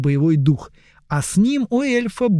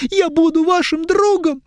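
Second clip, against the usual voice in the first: put-on high, pleading young elf; whiny elf; 13 semitones higher, 3 dB louder than usual. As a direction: beg high and tearful.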